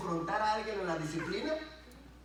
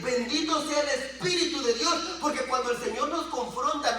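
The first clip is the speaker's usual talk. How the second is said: raised, emphatic sermon delivery; forceful preaching; 8 semitones higher, 5 dB louder than usual. Real, not acted. Preacher confronting the congregation about sin, forceful preaching. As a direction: press each phrase on the congregation.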